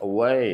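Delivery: "away" with loud, rising "way" after a small "a"; stress on second syllable. Shown as a demonstration. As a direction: punch "way" in "away". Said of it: "way മേലെ". ("away": "a" താഴെ, "way" ഉറക്കെ മേലെ)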